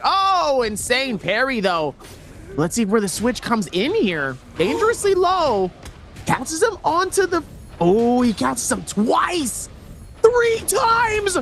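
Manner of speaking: high, excited escalating fight call; live play-by-play. Real, not acted. Caster calls repeated catches live, rising excited play-by-play.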